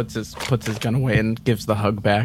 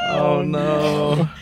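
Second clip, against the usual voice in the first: long, drawn-out wail of dismay; louder, higher groan, held out; 5 semitones higher, 3 dB louder than usual. Real, not acted. Player mourning a disastrous reroll, dismayed groan.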